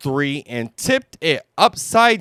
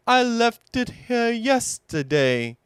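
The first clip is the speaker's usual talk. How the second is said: Pooh voice; 11 semitones higher than usual. Acted. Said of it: "Pooh explained".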